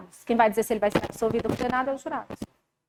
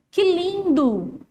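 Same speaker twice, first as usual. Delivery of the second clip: sarcastic; 5 semitones higher, 5 dB louder, much slower than usual. Acted. Teacher mocks a wrong argument.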